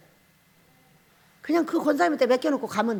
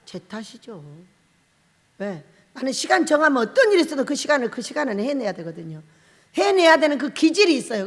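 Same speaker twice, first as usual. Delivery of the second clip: normal talking voice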